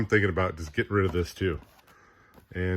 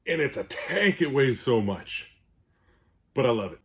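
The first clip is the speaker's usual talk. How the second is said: out of breath; 4 semitones higher, 3 dB louder than usual. Real, not acted. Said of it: "so I'm out of breath".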